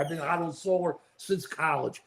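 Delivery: normal talking voice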